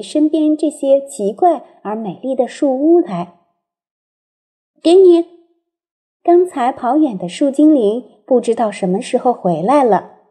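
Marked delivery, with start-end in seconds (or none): 4.8-5.3 bright loud little call; eager call. A narrator's reading. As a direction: hand it over eagerly. Sprite returns offering bowl.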